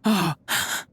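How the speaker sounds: sharp gasp of disbelief; shocked gasp. Acted. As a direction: gasp as if the prize has vanished. Finds the jar empty.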